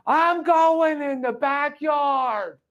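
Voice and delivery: high, slow, loud call to the whole house; announcing loudly. Retold or imitated. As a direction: call it out slowly so everyone inside hears. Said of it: "I'm announcing it to the whole house".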